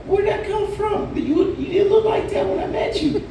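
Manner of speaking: incredulous. Retold or imitated. Comic asking his date where her wig came from, incredulous. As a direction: act stunned and baffled.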